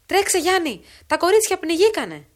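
loud, high desperate shout; sick mother screaming for her husband to run, straining; straining cry. Acted. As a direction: force the shout out with great effort. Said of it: "Έκραξε με μέγαν κόπον η άρρωστη γυνή".

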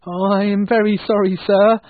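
high, loud, frightened pleading; scared clerk, voice pitched right up; fearful apology. A narrator's reading. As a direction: apologise in alarm to an angry boss. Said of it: "said Bob with fear in his voice".

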